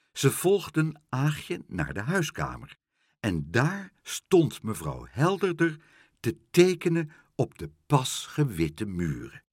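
usual speaking voice

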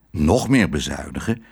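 usual speaking voice

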